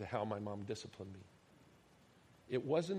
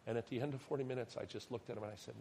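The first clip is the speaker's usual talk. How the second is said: ordinary talk, nothing marked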